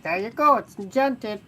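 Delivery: bright, loud mock of a lazy mortal wizard; high, loud dismissive parody; mock mortal enchanter. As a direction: toss it off like a cheap trick. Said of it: "you're like".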